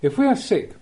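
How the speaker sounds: ordinary talk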